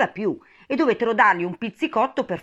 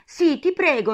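high, urgent plea; imploring; 10 semitones higher, 6 dB louder than usual. A narrator's reading. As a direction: beg eagerly. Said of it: "implorò Alice". (supplica acuta e insistente)

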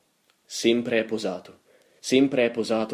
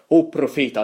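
loud, higher theatrical cry; declaimed, raised-voice address; impassioned invocation; 6 semitones higher, 9 dB louder than usual. A narrator's reading. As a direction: call out to the prophet with force. Second anguished address to the raven.

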